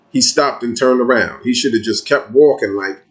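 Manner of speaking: ordinary delivery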